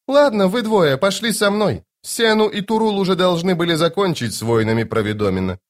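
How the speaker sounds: high, airy lady's voice; Lady Envy, brisk command. Acted. Lady Envy summoning two men, brisk and high. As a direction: give orders in a high feminine register.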